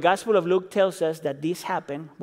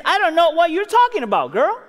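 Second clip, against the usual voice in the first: put-on a raised, pointed denial to the girl; Peter denying; 8 semitones higher, 4 dB louder than usual. Preacher voicing Peter's raised denial to the servant girl. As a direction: brush her off with a lifted voice. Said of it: "And he says".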